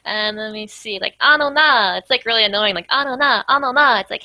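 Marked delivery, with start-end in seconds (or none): 1.2-2.0 lightly voiced pestering kid, higher and louder; little kid. Imitating the little sister pestering, lightly kid-voiced. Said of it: "like a little kid would say that". 2.9-4.0 lightly voiced pestering kid, higher and louder; little kid. Imitating the little sister pestering, lightly kid-voiced. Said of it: "like a little kid would say that".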